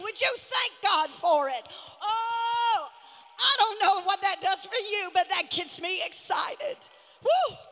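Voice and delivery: loud shouted sermon ending in a whoop; preaching at full force. Acted. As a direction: preach it with all your power, up to a cry.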